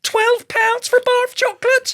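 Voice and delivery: high squeal of disbelief; outraged at the price, way up high; shocked disbelief. Real, not acted.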